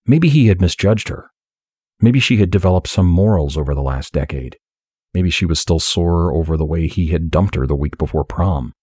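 ordinary delivery

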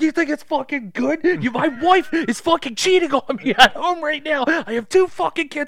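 high, loud screaming rant; boss losing it. Acted. Supervisor screaming at the teens about his life, furious. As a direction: scream out a breakdown. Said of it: "loses it".

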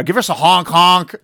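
honking like a clown horn; loud goofy honk honk; clown honks and squeaks. Pretend. Asking chat for honk emojis.

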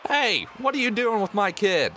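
high, sharp parental demand; angry halfling parent. Acted. Halfling parent catching the player with the kid, accusing. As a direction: call down at the stranger with your child. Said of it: "look down at you and go".

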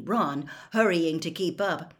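ordinary delivery, nothing marked

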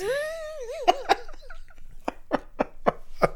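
high wheezing laugh that tips into a sob; crying laugh. Real, not acted. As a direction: laugh until it almost sounds like crying. Laughing at puppy dog eyes.

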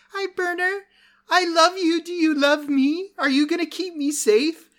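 put-on high little kobold child asking for safety. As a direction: squeak it up like a trusting little kid. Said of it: "young children kobolds".

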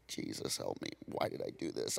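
unvoiced, desperate whisper; whispered prayer. Preacher retelling his panicked prayer at the stall, whispered. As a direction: breathe a panicked plea under your breath.

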